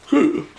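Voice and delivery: quick shocked intake of breath; sharp high gasp. Acted. Narrator reacting to the snapped wire, sharp high gasp.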